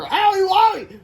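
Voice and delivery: very high, loud mock pain; hammed-up owie. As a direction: yelp like a hurt cartoon villain. Villain hurt.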